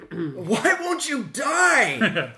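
slow, high, loud yell; hammed-up cry at a stubborn foe; exasperated battle shout. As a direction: drag out each word in a frustrated shout. Player yells at enemy in character.

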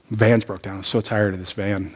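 a low, fed-up grumble over the van; mild frustration. Retold or imitated. Pastor fed up with the broken van, low fed-up grumble. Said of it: "And I'm mad".

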